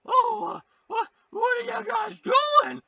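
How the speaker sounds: put-on high shouted startled kid voices; high mock kids. Kids walking in on the scene, high startled shout. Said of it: "they're like".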